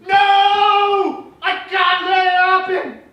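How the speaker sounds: high, very loud drawn-out cry; melodramatic stage hero. Acted. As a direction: belt it out in full theatrical desperation. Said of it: "a brief montage of every character".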